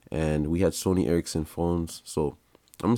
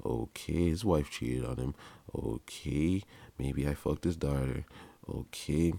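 soft, muttered private thoughts with rising okays; thinking to himself under his breath; inner thoughts; 2 semitones lower, 5 dB quieter than usual. Retold or imitated. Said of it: "That's what I'm thinking in my head".

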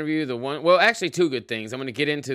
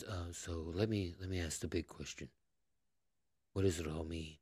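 hushed, much softer lofty musing; mock Lex Fridman; 8 semitones lower, 16 dB quieter than usual. Podcaster mocking his guest-host's lofty questions, hushed mock musing. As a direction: murmur a grand question like a soft-spoken podcast host. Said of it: "And he'll always ask".

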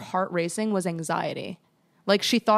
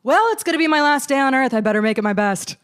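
loud, raised-pitch dramatic declaration; mock doom; 5 semitones higher, 5 dB louder than usual. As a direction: announce your imagined doom with hammed-up resolve. Mock morning speech of doom.

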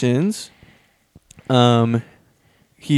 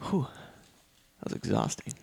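breathy tired whisper; exhausted whisper; 17 dB quieter than usual. Real, not acted. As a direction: sigh it out, drained. Worn out after long talk.

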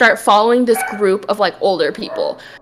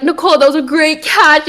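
retold teammate's hype, pitched higher; hyping up; 4 semitones higher than usual. Teammates to Nicole after her catch, hyping her up. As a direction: imitate a friend talking up a play. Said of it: "you know, just hyping me".

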